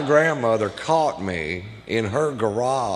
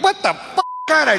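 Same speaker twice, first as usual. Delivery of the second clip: shocked squeak. Retold or imitated. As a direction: voice jumps way up in startled disbelief. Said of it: "I'm like".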